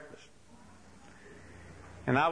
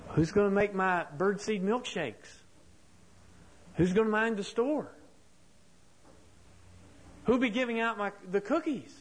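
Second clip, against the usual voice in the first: put-on higher, rising worried questions of a childlike bird; a hint of Big Bird's dismay, climbing higher; upset Big Bird; 6 semitones higher than usual. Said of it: "And then that hits him. And he said".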